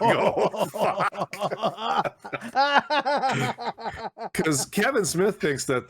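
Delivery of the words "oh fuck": high-pitched mock dismay through laughter; retold "oh no" moment, laughing. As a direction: curse while cracking up.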